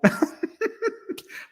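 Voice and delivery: breathy high cackle of delight; high burst of laughter. Real, not acted. Host cracking up at his own story, high laugh.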